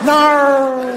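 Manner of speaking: high, drawn-out Australian "no"; mock Australian accent. Australian office worker jumping out, high drawn-out cry in mock Australian accent. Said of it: "an Australian guy in the office".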